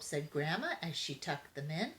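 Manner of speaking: ordinary delivery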